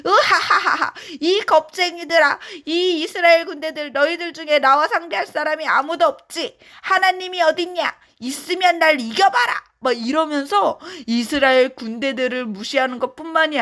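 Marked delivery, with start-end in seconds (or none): 0.0-0.9 high booming villain laugh; hammed-up mocking cackle; mocking giant laugh. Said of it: "뭐라고 이야기하는가 봤더니요". 1.2-9.6 higher, taunting bully voice; hammed-up giant's taunt; mocking Goliath. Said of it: "막 비웃고 막 조롱하는".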